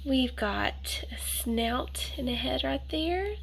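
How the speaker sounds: slow hushed whisper. Real, not acted. Reading to a child, slowly whispering what he sees. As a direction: whisper it slowly, tracing the picture.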